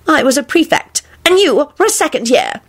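high-pitched pomposity; haughty. A narrator's reading. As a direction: pull rank on an inferior. Percy haughtily pulls rank on Neville.

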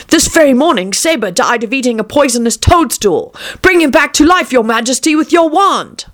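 high-pitched captain pleading with his king; urgent captain. A narrator's reading. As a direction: report a death and beg for help.

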